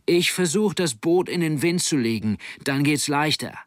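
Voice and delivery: high, energetic called explanation; calling out. Acted. Helmsman explaining his plan to his mate, calling out. Continues calling instructions over wind.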